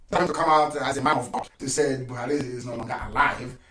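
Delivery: normal talking voice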